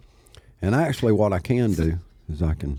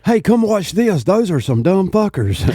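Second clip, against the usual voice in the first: high, louder put-on heckler voice; mocking listener; 12 semitones higher, 5 dB louder than usual. Imagined listener calling others over, high mocking voice. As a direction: call friends over to gawk at idiots. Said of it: "they'll be like".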